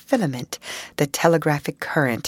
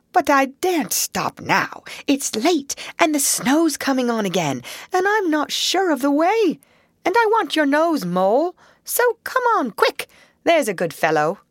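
a higher, brisk Rat voice pressing on; Rat urging; 8 semitones higher than usual. Acted. Rat urging Mole to hurry before the snow, brisk. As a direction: hurry him along kindly but firmly.

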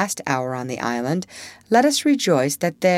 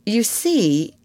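slightly higher, mildly apologetic; 5 semitones higher than usual. A narrator's reading. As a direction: ease into a timid excuse. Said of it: "he continued apologetically".

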